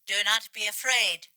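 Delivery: ordinary delivery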